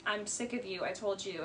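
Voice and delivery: calm and done with him; poised, quiet retort. Retold or imitated. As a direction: Katie coolly dismissing Schwartz, softly. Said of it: "she acts very, very poised".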